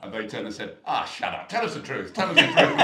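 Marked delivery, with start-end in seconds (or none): 0.8-2.1 louder, laughing scoff; laughing disbelief. Retold or imitated. Ladies mock his claim. 2.1-2.8 much higher and louder, shrieked; shrieking disbelief. Retold or imitated. Ladies mock his claim.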